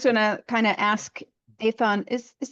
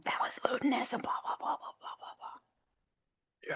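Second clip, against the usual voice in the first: unvoiced secretive whisper, fading away; 2 semitones higher, 13 dB quieter, slower than usual. Player's character goes over to whisper to an ally, whispering. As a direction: whisper it to an ally, trailing into slow gibberish. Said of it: "and whisper to him".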